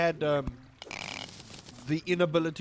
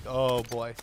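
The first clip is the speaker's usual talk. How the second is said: ordinary delivery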